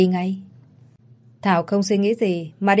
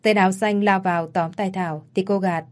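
ordinary delivery, nothing marked

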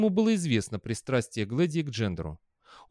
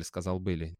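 plain reading voice

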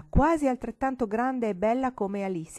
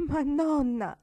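tearful voice; 7 semitones higher, 4 dB louder, slower than usual. Acted. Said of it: "con voce di pianto".

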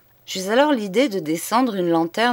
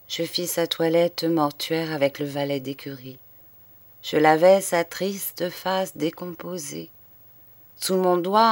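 ordinary delivery

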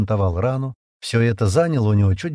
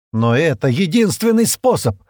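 louder, higher, urgent insistence; almost shouting; 7 semitones higher, 6 dB louder than usual. A narrator's reading. Стэн insists to Шира it's the only way, near shout. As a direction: insist at nearly a yell. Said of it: "почти закричал Стэн".

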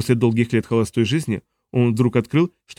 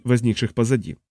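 ordinary delivery

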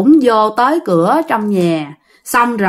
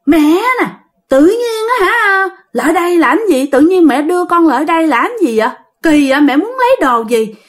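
high, whiny possessed husband; pleading like a woman trying to act tough; high wheedling womanly voice forced through a man; 7 semitones higher than usual. Retold or imitated. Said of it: "cái kiểu ổng giống như đàn bà vậy đó".

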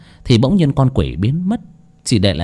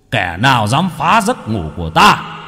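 booming like thunder; 9 semitones higher than usual. Acted. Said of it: "cất giọng ồm ồm như sấm".

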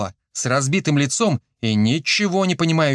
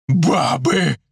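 slightly raised accusing cry; growing anger, only half roared; mild roar; 3 semitones higher, 3 dB louder than usual. A narrator's reading. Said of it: "взревел самый здоровый". (сдержанный рёв)

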